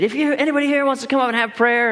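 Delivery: high, fast preacher's altar call; fiery preacher call. Retold or imitated. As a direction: sweep the room up to the front like a fiery preacher. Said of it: "he's a super fiery preacher".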